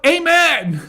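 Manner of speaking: high, loud amen cry; shouted amen. Retold or imitated. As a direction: call it out over a silent audience. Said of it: "shouted out".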